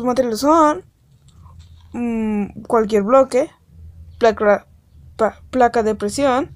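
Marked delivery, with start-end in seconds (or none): none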